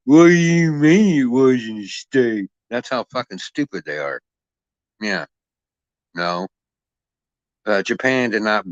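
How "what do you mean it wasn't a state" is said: loud, higher, slowed mock disbelief; mocking dumb person. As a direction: play the clueless idiot, hammed-up. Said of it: "And they'll go".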